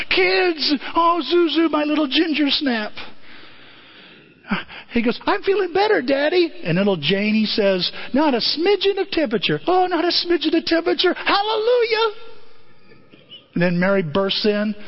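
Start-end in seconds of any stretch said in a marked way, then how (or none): 0.1-2.9 joyful greeting. Retold or imitated. George greeting his children. 5.3-6.5 put-on child voice. Zuzu to father. 8.1-12.1 high, rising joyful exclaim; rejoicing. Retold or imitated. George rejoices his daughter is well, high joyful cry.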